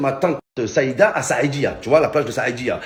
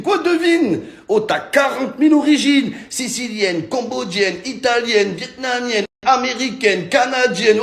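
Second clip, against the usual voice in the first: exasperated outcry; 9 semitones higher, slower than usual. Real, not acted. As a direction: burst out in disbelief. Exasperated outburst.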